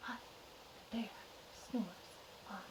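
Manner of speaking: soft, halting storyteller hush; hushed refrain. A narrator's reading. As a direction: let each piece drop softly, with pauses between. Hushed sleeping-bear refrain in story.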